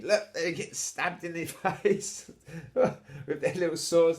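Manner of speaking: chuckling through the words; laughing. Real, not acted. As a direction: laugh as you talk. Laughs while describing.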